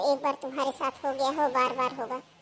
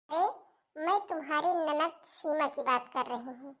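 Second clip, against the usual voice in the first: ordinary talk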